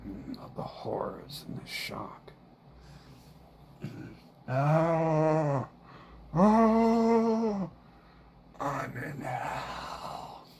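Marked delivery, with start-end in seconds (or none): 4.5-10.5 anguished groan. Real, not acted. Groans in anguish then laughs.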